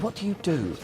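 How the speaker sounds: lightly voiced bored transport cop; policeman's question. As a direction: do the suspicious policeman sizing you up. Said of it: "what a policeman once said to me".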